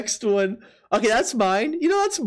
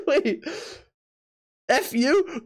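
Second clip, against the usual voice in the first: normal talking voice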